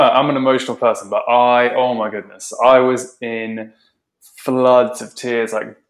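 usual speaking voice

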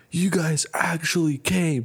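high, drawn-out voice of joyful amazement; overjoyed disbelief. Retold or imitated. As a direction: imitate a man amazed and delighted, slowly. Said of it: "I never seen such joy in my life".

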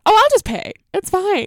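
high, loud airy offer; mock breezy offer. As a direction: toss it off like it's nothing, loudly. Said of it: "did the thing of".

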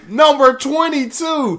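high and loud, calling it out to the crowd; hype announcement. Acted. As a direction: keep the stadium MC energy going. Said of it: "We've come to the moment".

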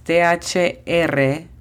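letters spaced out for learners; spelled slowly for a beginner class. Shown as a demonstration. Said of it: "La pronunciación fonética es".

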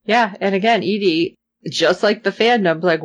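normal talking voice

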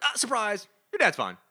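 high, loud mock surprise; spring the trick on him; cheeky Groot shouting surprise. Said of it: "Groot's like".

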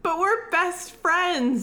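high, drawn-out protest; slow, high-pitched comic wheedle; mock pleading. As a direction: plead with your best friend, playfully wounded. Mock pleading after being refused.

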